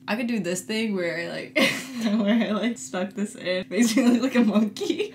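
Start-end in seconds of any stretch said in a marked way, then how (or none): none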